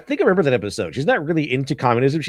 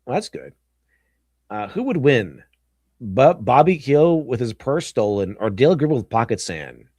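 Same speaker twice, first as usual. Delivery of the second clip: usual speaking voice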